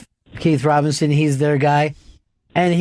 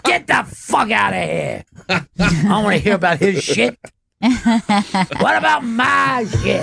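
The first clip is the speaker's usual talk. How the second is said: mock indignant shout, the last line slowed and pitched up; Keith's gruff brush-off; 3 semitones higher than usual. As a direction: play the jealous comic grabbing the spotlight. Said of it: "Just to".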